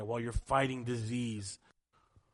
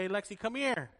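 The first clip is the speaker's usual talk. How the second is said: put-on higher-pitched call to a child; 8 semitones higher than usual. Adults summon Lexi from the restroom, calling out. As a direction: call her over from across the room.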